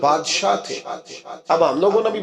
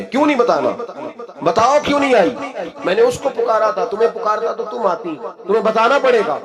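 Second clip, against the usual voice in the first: angry; 5 semitones higher than usual. Retold or imitated. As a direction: insist on the truth with rising anger. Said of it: "کو غصہ آیا".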